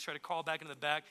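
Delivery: usual speaking voice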